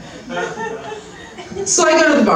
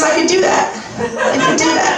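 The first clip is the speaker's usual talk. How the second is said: laughing agreement; 7 semitones higher than usual. Retold or imitated. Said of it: "She goes".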